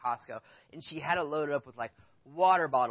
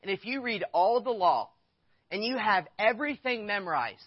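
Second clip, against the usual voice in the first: higher, louder building sermon; preaching climax; 8 semitones higher, 6 dB louder than usual. Acted. Pastor urging the congregation to love people, preaching at full force. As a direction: drive the point home to the congregation.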